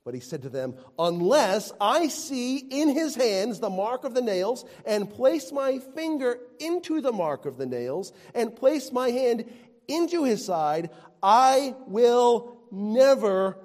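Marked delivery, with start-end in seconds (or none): 1.0-10.9 loud, high, insistent refusal; stubborn disciple declaring he won't believe; defiant Thomas. Acted. Preacher acts out Thomas's doubt. 11.2-13.6 slow emphasis. Acted. Drawn-out emphatic refusal.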